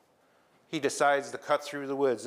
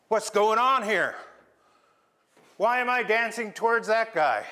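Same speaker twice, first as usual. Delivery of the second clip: higher, louder bewildered question; puzzled Crab; 6 semitones higher, 4 dB louder than usual. Acted. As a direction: Crab snapping out of the dance, confused. Crab suddenly realizes the trick.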